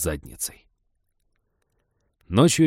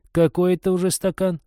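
teasing question; 10 semitones higher, 4 dB louder than usual. Acted. Friend sniffs, teasing.

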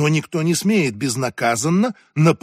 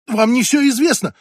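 higher, louder outburst; desperate cry; 9 semitones higher, 5 dB louder than usual. A narrator's reading. Lizon desperately defends herself to the senator's wife, crying out. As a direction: cry it out as if clinging to a secret. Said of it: "выкрикнула Лизон".

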